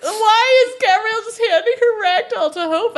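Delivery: high, loud, laughing disbelief; exasperated, high-pitched demand for an explanation; incredulous outburst. Real, not acted. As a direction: squawk in amused bafflement. Mock outrage at a baffling plot.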